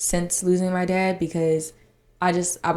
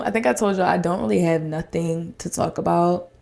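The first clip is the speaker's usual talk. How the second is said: ordinary delivery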